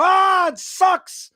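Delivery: mock shout about the show; mock whiny shout. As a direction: wail like a betrayed fan. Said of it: "they go".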